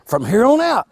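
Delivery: ordinary talk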